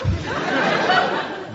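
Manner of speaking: very high breathy giggle. Acted.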